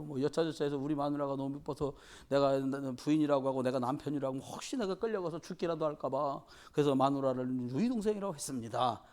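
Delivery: usual speaking voice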